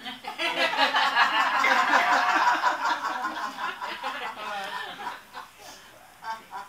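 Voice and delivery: long loud burst of laughter. Real, not acted. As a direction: crack up at the punchline. Laughs at her own story.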